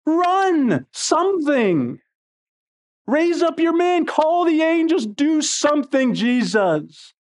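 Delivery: high-pitched, exasperated urging; frustrated plea. Retold or imitated. Onlooker urging Jesus to act, frustrated. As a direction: plead for action, pitch way up. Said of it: "I get frustrated watching these things".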